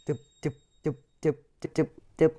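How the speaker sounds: vocal popping sounds. Pretend. Mouth sound while cards open.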